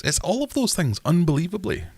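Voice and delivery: high, amazed reveal; mock amazement. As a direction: sound astonished at the answer. Amused reveal.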